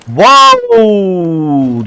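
long slow amazed wow; drawn-out wow. Real, not acted. Gamer marvelling at his own play, slow amazed wow. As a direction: stretch the wow out in awe. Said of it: "başka bir şey diyemiyorum".

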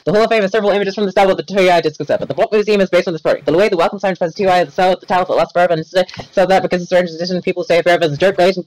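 hurried read-aloud, pitch up, words tumbling out; rushed reading. Real, not acted. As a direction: race to finish reading before getting cut off again. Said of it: "I'm gonna finish this".

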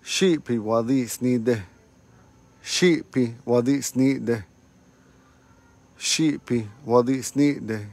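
Shipi wadi snide, each syllable slow and clear; slow teaching demo. Shown as a demonstration. Teacher to online students, slow careful demo. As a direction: slowly spell out Shipi wadi snide for the class. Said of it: "Man, you're late again".

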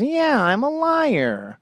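high, drawn-out catchphrase voice; Jon Lovitz. Retold or imitated. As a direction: stretch it out slowly in a comic actor's high voice. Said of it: "the shitty S N L actor who says".

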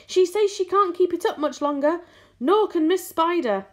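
high, loud protest; yell. A narrator's reading. Centipede warning that the spinners are tiring, yelling. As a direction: keep yelling upward, pitched high. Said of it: "yelled the centipede from below".

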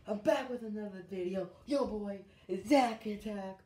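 loud, high, punchy intro shout; hyped intro. Shown as a demonstration. YouTuber showing viewers one option for his intros, hyped and loud. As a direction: belt out a big YouTuber greeting. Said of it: "my intros be like this".

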